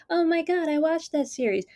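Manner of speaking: high-pitched mock squeal at a billboard; excited squeal. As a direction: squeal it, way up high, like spotting a show you love. Own reaction to billboard retold.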